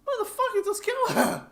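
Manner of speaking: high-pitched, loud mock outrage; exasperated outburst. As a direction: cry out in disbelief, pitch shooting way up. Acted outraged reply to villain's cruelty.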